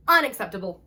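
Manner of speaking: loud, firm Unacceptable; emphatic. Shown as a demonstration. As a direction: say Unacceptable. with full force.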